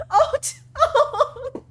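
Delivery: high, laughing cry; laughing wail. Real, not acted. Streamer laughing at her own misfortune, high.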